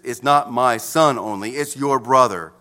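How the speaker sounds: ordinary delivery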